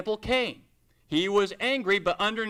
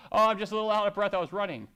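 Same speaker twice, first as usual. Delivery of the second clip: fast, breezy excuse into the phone; quick phone excuse; much faster than usual. Pretend. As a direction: brush it off quickly. Excuse on phone after argument.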